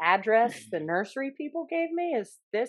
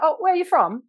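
higher-pitched mock British accent; retold British voice, pitched up; 9 semitones higher, 4 dB louder than usual. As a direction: imitate a British man asking a question. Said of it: "ran into this one British guy".

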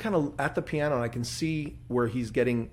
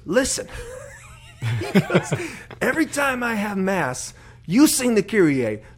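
high, loud mock exasperation; a fed-up priest, laughing as you scold; annoyed priest; 10 semitones higher, 7 dB louder than usual. Annoyed priest voiced, retold.

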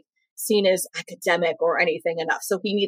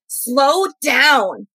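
drawn-out yell, higher than usual; imitated yell; 6 semitones higher, 4 dB louder than usual. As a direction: mimic a stenographer yelling at a lawyer. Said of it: "got yelled at".

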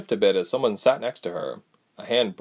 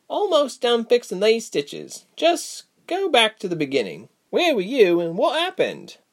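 higher, brisk, scolding healer woman; a sharper voice, irritated but caring; stern Reshma; 13 semitones higher than usual. A narrator's reading. Reshma's dialogue.

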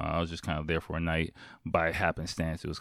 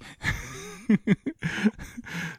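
giddy laugh. Retold or imitated. Laughing while celebrating at home.

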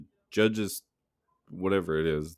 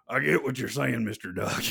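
higher, laughing celebrity impression; Gary Busey impression; 6 semitones higher than usual. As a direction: talk down to a duck like an eccentric judge. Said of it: "Busey's like".

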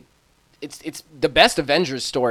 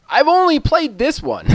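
high, loud voice of a sheepish voter; quoting a voter; 12 semitones higher, 5 dB louder than usual. Retold or imitated. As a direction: pitch it way up as the clueless voter. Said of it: "people were like".

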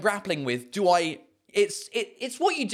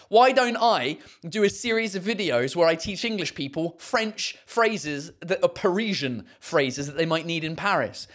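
ordinary talk, nothing marked

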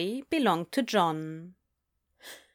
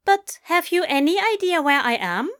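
high-pitched, enunciated British query; precise British accent; 8 semitones higher, 3 dB louder than usual. A narrator's reading. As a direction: ask politely in a precise, raised British voice. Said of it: "British accent".

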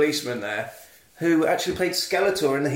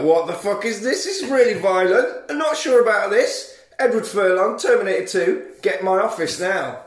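higher and louder, indignant teacher; outraged teacher; 5 semitones higher, 4 dB louder than usual. Retold or imitated. Teacher catching the horror club, mock outrage. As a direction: play the appalled eighties teacher. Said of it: "a teacher comes in and he's like".

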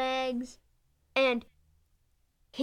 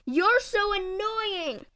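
held-back scream; 8 semitones higher, much slower than usual. Acted. Comic character nearly screams at teammate, slow and high. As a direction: drag it out like a scream swallowed. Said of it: "so close to screaming".

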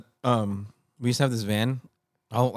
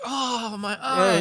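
high-pitched fake cry of pain; mock pained cry; 13 semitones higher, 3 dB louder, much slower than usual. Prankster pretending to mom the door hit them, mock pained cry. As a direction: cry out as if hurt, hammed up. Fakes an eye injury to mother.

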